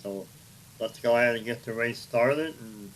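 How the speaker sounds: ordinary talk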